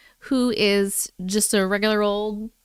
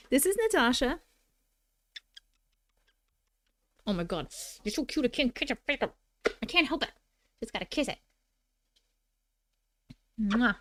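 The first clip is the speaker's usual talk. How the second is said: soft pet voice; 7 dB quieter than usual. Real, not acted. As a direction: fawn over the cat softly. Cooing over the cat.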